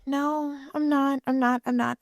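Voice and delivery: embarrassed high-pitched protest; flustered high denial. Retold or imitated. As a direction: backpedal fast and high.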